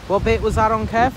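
loud call across the beach. Real, not acted. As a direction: shout the question to a friend far off. Calling to friend across beach.

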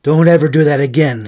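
a touch of loud anxious pleading; mild pleading. Acted. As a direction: scold and beg her at once. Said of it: "he pleaded".

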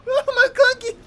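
squeaky high laugh on the name; high laughing squeal. Real, not acted.

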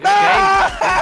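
sudden excited yell. Real, not acted. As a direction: shout with joy. Win reaction.

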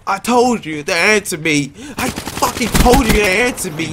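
high, loud ranting shout; angry yelling. Real, not acted. Raging gamer yelling at teammates over voice chat, angry shout. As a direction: scream at them to answer you.

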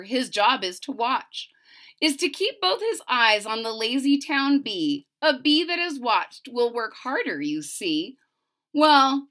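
lively, bouncy read-aloud of rhymes; storybook narration. A narrator's reading. Reading a rhyming book to a child, lively narration.

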